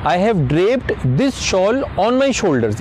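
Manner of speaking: very slow, clear English phrase; slow model sentence. Shown as a demonstration. Teacher modelling a phrase for viewers, very slow. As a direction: say it slowly so they can repeat. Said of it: "For example".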